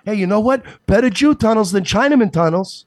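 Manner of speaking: put-on a concerned neighbor in a Boston accent; concerned Mark Wahlberg. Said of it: "impression of a concerned citizen Mark Wahlberg".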